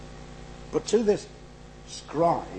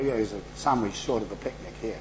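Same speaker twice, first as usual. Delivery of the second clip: mocking northern English accent, lower and softer; 5 semitones lower, 5 dB quieter than usual. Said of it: "when you hear".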